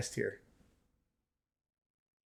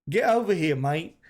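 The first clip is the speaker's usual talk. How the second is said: mock British accent, pitched up and punched. Shown as a demonstration. Gamer showing what British sounds like, mock British accent. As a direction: put on a British accent for the catchphrase. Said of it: "Nah, that's British".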